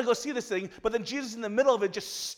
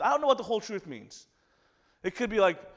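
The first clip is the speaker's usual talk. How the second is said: normal talking voice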